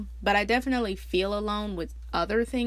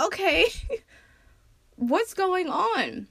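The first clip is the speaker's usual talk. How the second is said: bewildered laughing; 10 semitones higher, slower than usual. Retold or imitated. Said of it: "And I'm like".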